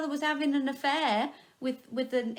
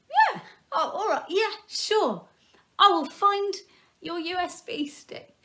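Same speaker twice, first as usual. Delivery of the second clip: much higher and louder, with a laugh; eager acceptance; 8 semitones higher, 4 dB louder than usual. Retold or imitated. Crabbe accepting the Prime Minister's request, excitedly high. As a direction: jump at the offer delighted.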